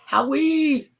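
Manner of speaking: high, bright call of the name; cheery greeting. Real, not acted. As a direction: sing out the name. Echoing greeting to Howie.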